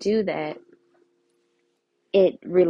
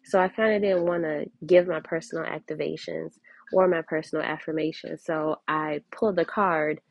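ordinary talk

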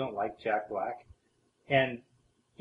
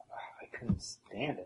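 whispering; 4 semitones higher, 11 dB quieter than usual. Real, not acted. Disgusted aside.

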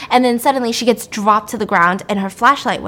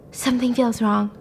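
ordinary delivery

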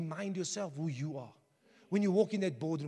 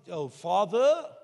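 a begging son; 9 dB louder, slower than usual. Retold or imitated. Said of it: "if my son must come to me".

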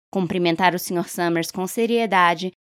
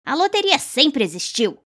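tom petulante e agudo, de velho teimoso; 8 semitones higher than usual. A narrator's reading. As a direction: diga como um velho rabugento que não cede. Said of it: "acrescentou em tom petulante". (velho Warner retruca, petulante e bem mais agudo)

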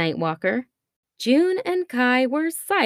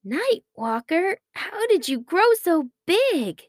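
high, wondering question; amazed; 7 semitones higher than usual. A narrator's reading. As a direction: gape aloud at something impossible. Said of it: "Kai stared at him in amazement".

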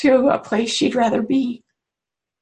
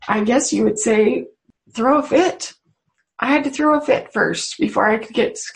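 ordinary delivery

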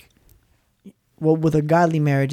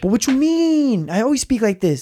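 mock harsh protest; 7 semitones higher than usual. Husband defending how he talks to the mediator, mock indignant. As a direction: act out a defensive, exaggerated retort. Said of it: "you speak really, really harsh".

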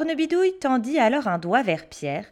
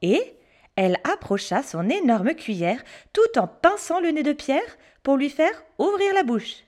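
ordinary delivery

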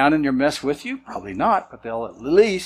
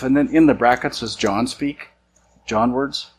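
ordinary delivery

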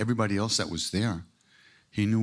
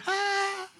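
a much higher, laughing "Ah!" in mimicry of the guru; imitating the guru's delighted "Ah". The guru, as he throws a banana, with a high laughing cry. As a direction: squeal it out like a delighted teacher. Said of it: "he looks and he goes".

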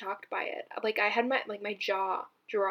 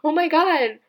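high, loud squeal of delight; excited gushing; 7 semitones higher, 11 dB louder than usual. Retold or imitated. Telling mom in the car, excited squeal. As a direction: gush like the song just floored you. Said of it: "I remember being like".

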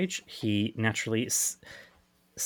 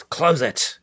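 louder, higher urgent command; acted yell; 6 semitones higher, 8 dB louder than usual. Host voicing Piper after Prue has gone through, yelled order. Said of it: "Piper yells".

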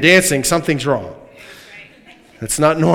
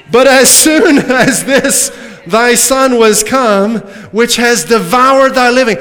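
louder, higher mocking whine with a laugh; mocking elder son; 6 semitones higher, 4 dB louder than usual. Preacher mocking the elder son's complaint, scornful laughing whine. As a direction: sneer it like the jealous brother.

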